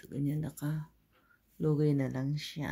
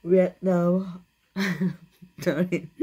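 ordinary talk, nothing marked

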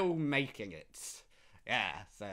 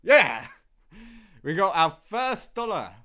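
high squealing laugh into loud cheer; real delighted whoop; celebrating; 8 semitones higher, 14 dB louder than usual. Real, not acted. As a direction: cheer the win. Celebrating first dollar.

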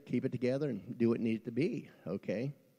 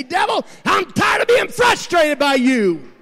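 slow, high, loud shout at the devil; mad at the devil; 15 dB louder, slower than usual. Acted. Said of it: "get mad at the devil".

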